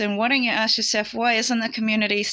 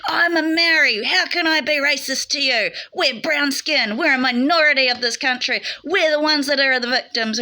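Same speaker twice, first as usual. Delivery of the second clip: higher, louder indignant comeback, slowing on brown skin; heated retort; 5 semitones higher, 4 dB louder than usual. Retold or imitated. Woman at the institution rejecting the racism charge, raised indignant voice. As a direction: fire it back at her, offended.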